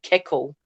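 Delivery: "keckle" with a k sound in the middle; imitating mum's "keckle" for kettle; mum's pronunciation. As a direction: say "keckle", a hard k replacing the t's of kettle. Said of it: "my mum calls it a".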